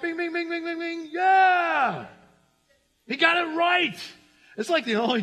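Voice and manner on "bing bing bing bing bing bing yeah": high, shouted mock bell ding for the winner; game show ding. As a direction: ring it out like a quiz show bell. Lights go off, right answer.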